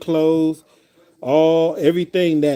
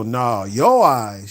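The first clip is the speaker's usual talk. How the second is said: slow drawn-out; 3 semitones lower, much slower than usual. Retold or imitated. Playful back and forth.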